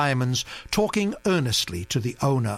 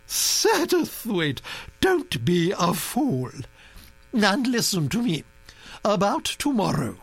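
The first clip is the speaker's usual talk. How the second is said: high, commanding grand-lady voice; a bossy old aristocrat laying down plans; imperious old Duchess; 8 semitones higher than usual. A narrator's reading.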